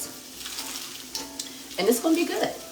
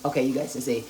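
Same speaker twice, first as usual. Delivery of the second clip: ordinary talk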